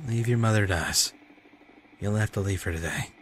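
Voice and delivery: ordinary talk, nothing marked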